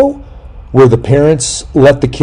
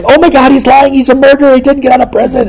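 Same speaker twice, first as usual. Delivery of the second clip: loud, high falsetto mock panic; alarmed viewer; 4 dB louder than usual. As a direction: squeal a warning at the screen. Acted viewer alarmed at the show.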